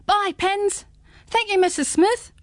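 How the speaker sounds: put-on high, bright chorus of kids; lightly voiced children calling; children calling goodbye. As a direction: call out goodbye like young friends. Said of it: "they all called".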